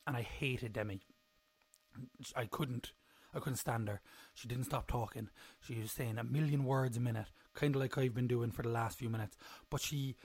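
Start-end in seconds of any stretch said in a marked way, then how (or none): none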